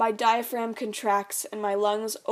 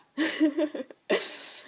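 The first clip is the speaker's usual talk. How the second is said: high burst of real laughter; can't hold the laugh in; real giggle; 7 semitones higher than usual. Real, not acted. Narrator cracking up mid take, laughing. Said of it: "That wasn't a part to laugh".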